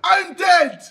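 high, loud cries of disbelief; slow, loud wail over losing; dismayed shouting. Real, not acted. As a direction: holler your defeat in protest. Disbelief at dying in game.

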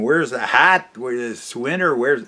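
ordinary talk

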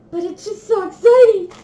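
high, loud giggly gush; laughing excitement. Real, not acted. Calling herself a dork, gushing with a laugh.

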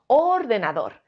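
"ordenador" stressed on OR; ÓR-de-na-dor, first syllable stretched. Shown as a demonstration. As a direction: hit OR in "ordenador". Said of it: "la sílaba tónica creemos que es or".

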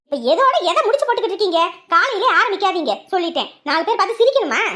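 fast, loud angry comeback; loud fast retort. Acted. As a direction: yell back quickly. Wife shouting at husband.

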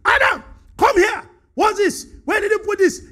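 loud, high, demanding call; God barking for Adam like a stern master; authoritarian summons. Retold or imitated. God summoning Adam with loud, high, demanding anger. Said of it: "that's not fellowship. That's authoritarian rule".

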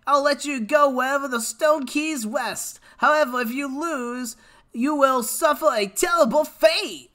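high cartoon hero laying down a challenge; Naruto. Streamer reading game villain's threat aloud, high Naruto impression. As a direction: hold a put-on, high anime character voice. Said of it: "I can't do Naruto".

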